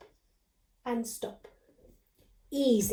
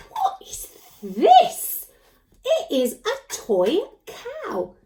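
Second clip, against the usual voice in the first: mock puzzlement; 7 semitones higher, 3 dB louder, much slower than usual. Teacher exaggerates puzzlement at puppet's toy.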